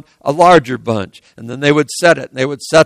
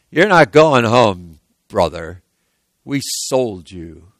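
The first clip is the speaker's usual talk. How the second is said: cold dismissal; 6 semitones lower, slower than usual. Acted. Brother telling Joseph he has been sold, cold and slow. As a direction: let it sink in, coldly.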